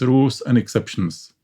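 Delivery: usual speaking voice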